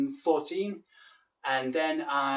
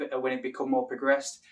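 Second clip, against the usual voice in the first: ordinary delivery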